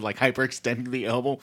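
usual speaking voice

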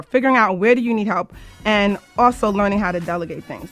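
usual speaking voice

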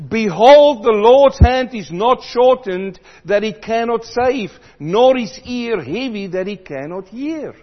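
loud, soaring preacher voice; preaching at full force. Acted. Preacher urging the congregation to return, at full force. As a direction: call it out over the church.

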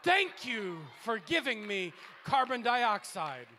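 slow, loud grateful tree; trees thanking. Pretend. Trees thanking the preacher for breath, slow and loud. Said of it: "and the trees say".

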